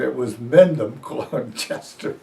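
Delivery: ordinary talk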